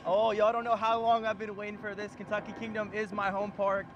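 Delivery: ordinary delivery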